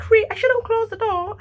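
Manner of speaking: squeaky high imitation of the neighbour; high mock neighbour. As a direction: mimic her high and puzzled. Imitating lady's reply.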